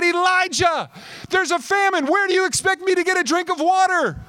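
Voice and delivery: high, loud, exasperated outburst; exasperated widow. Acted. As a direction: throw it at him in disbelief. Imagined widow scolding Elijah.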